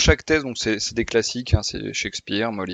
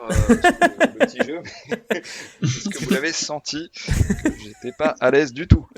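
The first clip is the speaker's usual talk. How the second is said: laughing; 7 semitones higher, 3 dB louder than usual. Real, not acted. Nervous laugh while introducing game.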